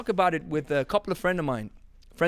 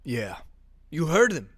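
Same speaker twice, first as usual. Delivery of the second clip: ordinary delivery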